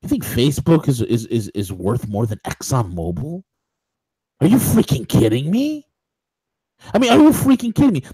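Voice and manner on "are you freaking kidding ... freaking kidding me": high-pitched, exasperated disbelief; incredulous outburst. Real, not acted. Host scoffing at Facebook's valuation, incredulous outburst. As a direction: demand it in rising disbelief.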